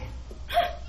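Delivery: high, quick mock gasp; acted gasp. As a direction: gasp as if overcome, acting it out. Acted reaction to landlord's praise.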